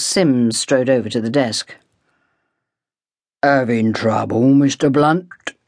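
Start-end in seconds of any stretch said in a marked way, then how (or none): 3.4-5.5 slow, lightly lowered burly porter voice; a hint of a big man's easy menace. A narrator's reading. Porter strolling over to the desk, slow lightly lowered burly voice. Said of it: "Flexing his muscles".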